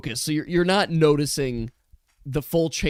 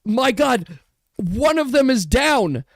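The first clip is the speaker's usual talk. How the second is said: alarmed arena announcer, higher and louder; fighting game announcer; 7 semitones higher, 4 dB louder than usual. Acted. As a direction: announce the disaster over the crowd. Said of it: "the arena announcer is like".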